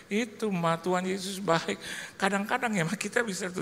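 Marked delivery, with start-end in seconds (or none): none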